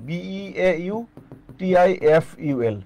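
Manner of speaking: ordinary delivery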